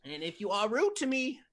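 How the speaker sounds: folksy old Southern lady with a hint of menace; old lady Southern accent. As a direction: play a sweet old Southern lady, lightly. Old lady Southern character intro.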